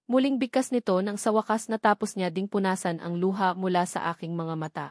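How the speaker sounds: usual speaking voice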